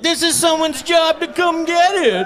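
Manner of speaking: high, slow smug voice; hammed-up smug excuse; mock entitled shopper. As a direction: say it like a lazy know-it-all. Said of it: "They're like".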